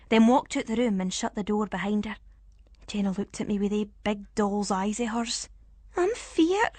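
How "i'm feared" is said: high, small frightened voice; scared little girl. Acted. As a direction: whimper it like a scared child. Child character voice.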